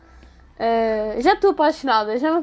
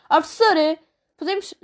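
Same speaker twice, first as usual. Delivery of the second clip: higher, louder mock call to the teacher; loud classmate impression; 5 semitones higher than usual. Imitating the loud girl asking the teacher at the end of class, mock yell. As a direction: belt it out like the girl asking to leave. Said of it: "é aos berros".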